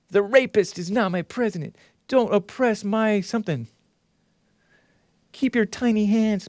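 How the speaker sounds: lightly voiced mock protester. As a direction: sneer the slogans. Mocking sign reading.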